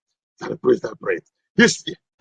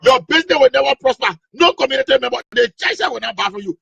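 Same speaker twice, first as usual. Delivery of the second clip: angry preaching; 8 semitones higher than usual. Acted. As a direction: drive him out like an angry pastor. Pastor curses casket seller.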